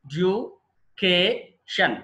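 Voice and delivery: Education very slowly in four separate pieces; syllable by syllable. Shown as a demonstration. Teacher counts out four syllables, very slow and separated. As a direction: split education as E, DU, CA, TION with gaps. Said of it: "four syllables ఉన్నాయి".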